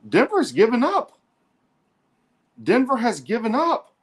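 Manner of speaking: high, incredulous call; stunned disbelief. Real, not acted. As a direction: sound amazed the champs quit. Stunned caller, team quits.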